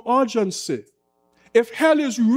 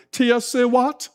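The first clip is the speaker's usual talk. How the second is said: normal talking voice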